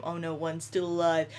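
normal talking voice

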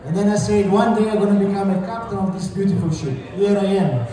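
normal talking voice

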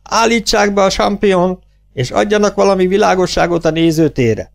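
high-pitched commands across the hall; shouted order. A narrator's reading. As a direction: call out orders to the crew. Shouted orders to stage crew.

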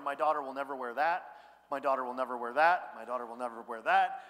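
low, firm mock grumble, repeated; deep-voiced, emphatic fatherly disapproval, played for fun; disapproving dad. Acts himself judging passers-by from chair.

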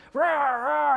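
imitated big-cat roar; jaguar roar. Jaguar coming down on the bear cub, imitated roar. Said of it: "he's yelling at him".

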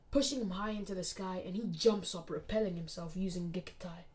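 usual speaking voice

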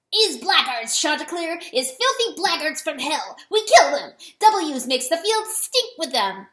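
loud, high, fierce weasel rant; furious shouting. A narrator's reading. Weasel rages to rooster about serpents, furious shouting. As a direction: spit curses at the enemy at full voice.

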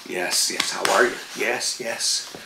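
low, soft cooing to a dog; imitated pet talk. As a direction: act out humouring a needy dog. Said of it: "So then I get".

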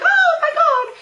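high, loud tearful squeal, acted out; retold starstruck wail; overwhelmed fan cry. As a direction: squeal it like a fan bursting into tears. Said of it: "so I started crying because".

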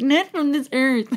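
high, slow odd put-on voice; weird voice. Shown as a demonstration. Mocking how it just came out, weird voice. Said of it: "sorry, that sounded weird".

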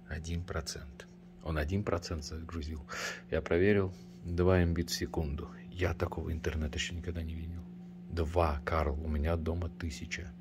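unvoiced incredulous whisper. Real, not acted. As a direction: whisper it in disbelief. Whispers in disbelief.

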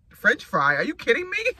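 exaggerated disbelief, loud then leaping high; mock incredulous. Son to mom about a French fry, mock incredulous. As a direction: imitate a son scoffing at an outrageous request. Voicing son's disbelief at request.

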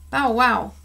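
bright loud bark; dog barking happily on the truck; happy bark. A narrator's reading. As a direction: bark eagerly. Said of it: "barked Carrie happily".

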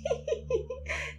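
breathless sprite giggle, high up. A narrator's reading. Sprite giggling at the narrator, high. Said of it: "kichert weiter und weiter".

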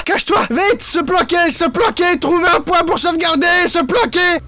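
high, urgent hurry; panicked urgency. Real, not acted. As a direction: rush to hide. Rushing to hide.

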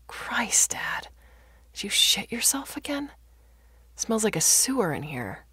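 high, airy disgust; disgusted complaint. Acted. Daughter holding her nose at her father's mess, disgusted. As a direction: recoil from a stench.

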